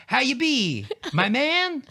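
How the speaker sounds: high, stretched jovial call; dad impression. Son mimics his dad hailing someone, high sing-out greeting. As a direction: hail a buddy high and drawn-out. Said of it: "He'd be like".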